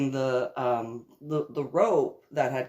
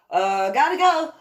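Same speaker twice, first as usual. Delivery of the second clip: quick and loud, already leaving; urgent exclaim; 10 semitones higher, 8 dB louder than usual. Retold or imitated. Rushing off when the bounce house breaks, loud. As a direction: blurt it as you run off.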